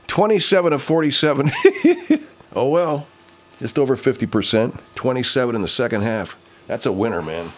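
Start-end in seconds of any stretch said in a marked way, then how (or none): 1.5-2.2 high-pitched burst of laughter; high giggle. Real, not acted. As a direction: giggle high at the absurd score. Laughing at the score.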